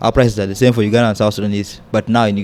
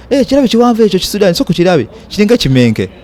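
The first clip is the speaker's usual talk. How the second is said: high, fast, sneering Luganda gossip; mocking gossip; 10 semitones higher, 4 dB louder than usual. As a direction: imitate locals jeering at a tall Sudanese behind his back. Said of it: "This thing of talking behind our backs".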